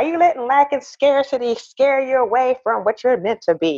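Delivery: lightly imitated coach, rising high on the question; a hint of a sassy coach calling her out; coach's voice. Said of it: "I'm doing it like in that, that voice".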